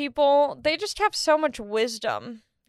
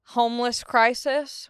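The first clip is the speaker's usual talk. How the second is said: ordinary talk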